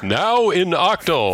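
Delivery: high, loud, slow stadium announcer. Retold or imitated. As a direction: boom it out like a ballpark announcer. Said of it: "in baseball stadium announcer voice".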